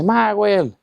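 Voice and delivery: high, drawn-out name call; imitated shout. Cousin searching calls his relative by name, high imitated shout. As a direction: holler the name high, without full volume. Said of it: "Y grita este nombre".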